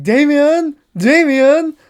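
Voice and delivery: high, loud panicked shouting of a name; frantic calling. Retold or imitated. Parents shouting for their missing son in panic. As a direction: call out for a missing child in panic.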